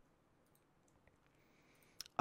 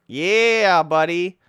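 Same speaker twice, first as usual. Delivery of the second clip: loud, raised, triumphant cheer; excited cheer; 4 semitones higher, 8 dB louder, much slower than usual. Real, not acted. Gamer cheering on a good move, excited.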